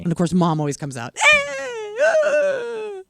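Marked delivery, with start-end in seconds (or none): 1.2-3.0 wailing mom. Retold or imitated. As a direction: wail like a distraught mother. Mom coming out in story.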